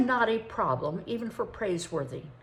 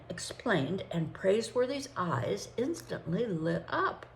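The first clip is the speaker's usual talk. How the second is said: usual speaking voice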